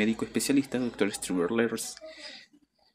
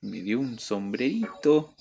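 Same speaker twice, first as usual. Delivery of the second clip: normal talking voice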